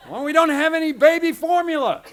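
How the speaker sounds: loud, high laughing mock yell; wife yelling at husband over no formula, laughing shout.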